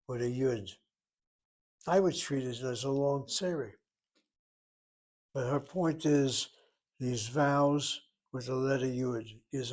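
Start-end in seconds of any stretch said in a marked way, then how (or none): none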